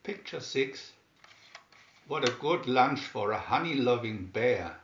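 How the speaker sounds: ordinary delivery, nothing marked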